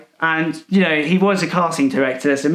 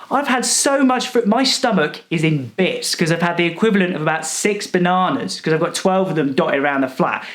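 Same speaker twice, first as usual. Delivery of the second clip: ordinary talk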